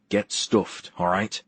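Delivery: ordinary delivery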